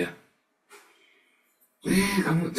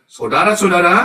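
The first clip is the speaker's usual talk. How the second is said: booming higher call of saudara-saudara; loud preacher call; 6 semitones higher, 10 dB louder than usual. Retold or imitated. Preacher imitating Pak Mulya addressing the crowd, booming call. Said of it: "kayak Pak Mulya ya".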